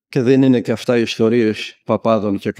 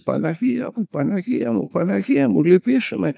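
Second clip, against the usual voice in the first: high-pitched mock wailing plea to the Virgin; imitated crying out; 8 semitones higher than usual. The woman at communion crying to the Virgin, high and pleading. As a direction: cry out high, pleading, as if overwhelmed by a miracle. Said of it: "Και να φωνάζει".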